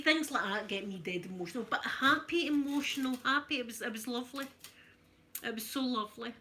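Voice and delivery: usual speaking voice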